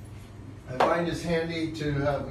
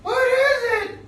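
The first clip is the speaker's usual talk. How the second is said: very high, loud, whiny squeak; puppet voice; 7 dB louder than usual. Acted. Puppet Boo Boo asking about the flashcard, squeaky and loud. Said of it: "and go".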